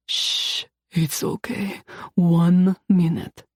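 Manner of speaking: soothing. A narrator's reading. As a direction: hush her gently, slowly. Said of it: "murmuring soothing words".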